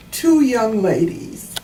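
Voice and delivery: usual speaking voice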